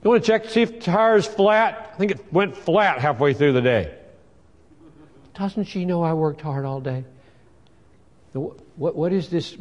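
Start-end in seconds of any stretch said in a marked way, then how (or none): 0.0-7.0 resentful husband. Retold or imitated. Husband's inner grumble voiced.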